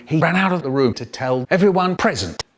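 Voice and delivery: usual speaking voice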